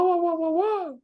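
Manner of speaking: squeaky high wah sounds; high imitation. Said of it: "but I've also heard a wah".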